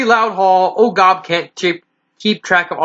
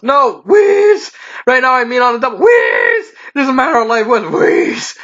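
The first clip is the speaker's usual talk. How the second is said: breathless panic; 4 semitones higher than usual. A narrator's reading. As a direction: blurt it between wheezes, panicking. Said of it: "gasping for breath".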